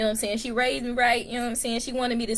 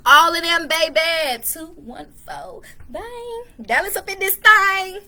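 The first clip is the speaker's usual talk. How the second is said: high, loud hometown hype; over-the-top rep-your-city yell; hyped shout-out; 8 semitones higher, 8 dB louder, slower than usual. Pretend. Hyped hometown shout-out, doing the most.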